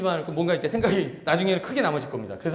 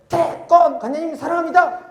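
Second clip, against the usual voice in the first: put-on cheerful kid; 8 semitones higher, 6 dB louder than usual. Child greeting master.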